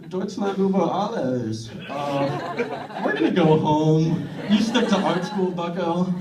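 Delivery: lightly voiced Hitler, German accent; casual Hitler in a light German accent; mild German accent. Imitating Hitler calling off the war, German accent. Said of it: "He's like".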